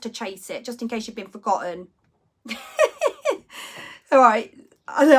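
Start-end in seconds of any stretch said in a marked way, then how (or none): 2.4-3.9 a breathy, high burst of real laughter. Real, not acted. Laughing at Wendy's comment in the chat. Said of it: "I'm just laughing".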